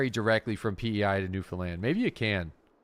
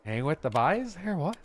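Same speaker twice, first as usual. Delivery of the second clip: higher, badly faked Newfie lilt with a rising "what?"; Newfie accent; 5 semitones higher than usual. Joking about the boys, mock Newfie accent. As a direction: have a loose go at a Newfie accent. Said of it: "I can't do a Newfie accent".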